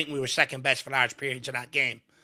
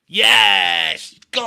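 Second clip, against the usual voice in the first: celebrating shout; 11 dB louder than usual. Real, not acted. Final whistle celebration.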